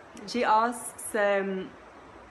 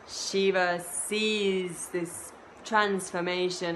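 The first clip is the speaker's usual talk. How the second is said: plain reading voice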